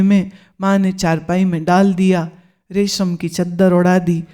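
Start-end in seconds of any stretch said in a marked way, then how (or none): none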